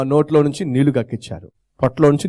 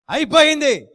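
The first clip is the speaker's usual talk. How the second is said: gloating cry; 12 semitones higher than usual. Acted. Preacher voicing Satan, gloating high that it's finished. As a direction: leap up high on అయిపోయింది and let it fall.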